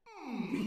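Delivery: high, laughing puzzled hum; hammed-up bewildered hmm; mock hum. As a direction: hum up high like a stunned stare. Imagining Josh staring at guard.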